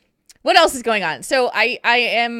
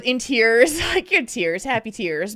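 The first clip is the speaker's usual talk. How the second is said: ordinary talk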